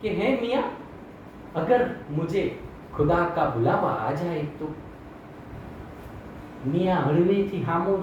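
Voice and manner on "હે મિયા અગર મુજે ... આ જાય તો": lower, mock-tender Hindi plea as the wife; a lightly voiced wife, softly fearing death; Mulla's wife asking. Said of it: "પત્નીએ પ્રશ્ન આવો જ કર્યો". (મુલ્લાની પત્નીનો હળવો હિન્દી સવાલ)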